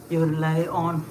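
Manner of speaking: ordinary delivery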